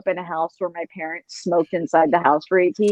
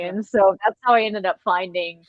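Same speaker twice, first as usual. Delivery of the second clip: usual speaking voice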